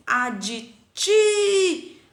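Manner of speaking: "Aditi-" with a very long, high, loud final TI; stressed syllable. Shown as a demonstration. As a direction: belt out and hold the TI of "Aditi-". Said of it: "são paroxítonas".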